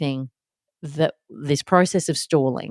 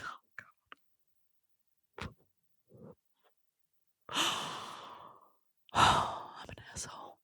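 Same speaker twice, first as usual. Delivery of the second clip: very soft whispered self-reproach; guilty whisper; 16 dB quieter, much slower than usual. Retold or imitated. Mum realising her daughter just loves her, guilty whisper. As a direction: whisper it to yourself, mortified.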